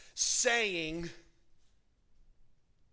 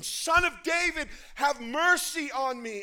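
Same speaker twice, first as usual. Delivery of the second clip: screaming plea; 8 semitones higher, 9 dB louder than usual. Acted. Blind beggar screaming to Jesus for mercy. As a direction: scream for mercy across the crowd.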